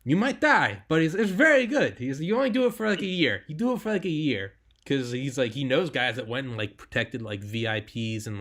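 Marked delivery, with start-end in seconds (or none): 0.0-2.0 high, loud attempt at an Israeli accent; mock Israeli accent, voice pushed way up. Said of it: "I can't do an Israeli accent". 2.2-4.5 put-on Israeli accent. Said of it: "I can't do an Israeli accent".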